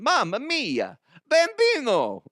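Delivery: high, hammed-up cartoon Italian; over-the-top Italian accent; mock Italian accent. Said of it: "she's speaking with an Italian accent".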